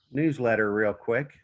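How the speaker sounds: ordinary talk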